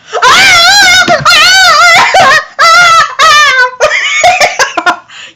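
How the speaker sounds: long, high, loud mock wail; lost-soul shriek. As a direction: shriek like a ghost, then break into laughter. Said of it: "shrieking like a lost soul".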